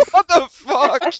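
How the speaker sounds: slow laughing "what the fuck"; laughing disbelief. Real, not acted. Laughing at the pumping joke.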